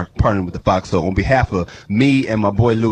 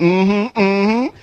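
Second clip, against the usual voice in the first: higher, smug hum; imitating his buddy; 9 semitones higher than usual. Buddy nodding at the ladies, higher humming. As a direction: hum along, pleased with yourself. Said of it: "He was nodding".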